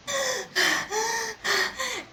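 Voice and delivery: a short high imitated sob, half laughing; mock sob. Describing her old crying fits, a mock sob. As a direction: act out a sob while describing it.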